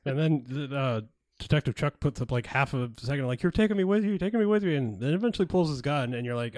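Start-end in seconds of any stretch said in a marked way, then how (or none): 3.4-4.7 much higher and louder, hammed-up insisting; mock insistent plea. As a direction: beg to come along, overdone. Chuck insists on coming.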